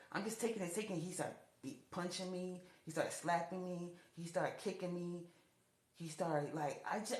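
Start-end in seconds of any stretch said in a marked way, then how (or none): none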